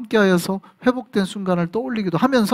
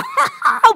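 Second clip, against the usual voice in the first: a high, squealing mock giggle; giggling teenager. Preacher mimicking teenagers laughing at nothing, high giggle. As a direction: burst into a silly girlish titter.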